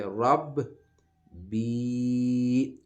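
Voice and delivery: رب held, then بی long and loud, high; teacher splitting رب بی for the class; broken recitation. Shown as a demonstration. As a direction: say رب and بی as separate slow syllables. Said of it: "تو اس کو ہم پڑھیں گے".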